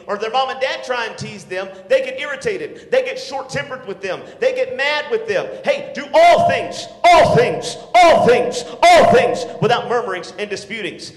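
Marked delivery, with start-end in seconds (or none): 5.6-11.1 louder and higher, hammering each all things; shouted command. Acted. Preacher to teens, shouting all things again and again. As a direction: bellow it, repeating all things.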